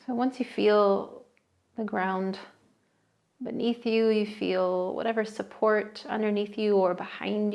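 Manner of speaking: soothing guided meditation voice. Acted. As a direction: settle the listener in, unhurried. Leads a seated meditation.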